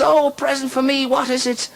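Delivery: high-pitched wife voice, thrilled; put-on falsetto for the wife; Murphy's wife. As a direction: squeal it as the delighted wife. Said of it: "she says".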